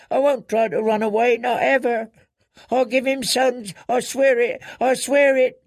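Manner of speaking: put-on very high, panicked girl's pleading; terrified girl swearing obedience; desperate begging. A narrator's reading. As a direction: beg frantically, stumbling from phrase to phrase.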